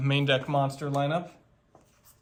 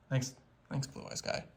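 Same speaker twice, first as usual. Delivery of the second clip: whispering; 3 semitones lower, 11 dB quieter than usual. Real, not acted. Whispered thanks after the bit.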